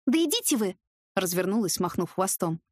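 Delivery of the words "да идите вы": high irritated brush-off; exasperated snap. Acted. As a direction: wave them all away. Героиня огрызается на друзей. (с досадой)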